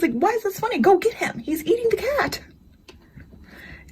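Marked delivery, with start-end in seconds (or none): 0.1-2.4 high, exasperated urging; demanding he go help now; upset pleading. Retold or imitated. Begging husband to rescue the cat, high and upset.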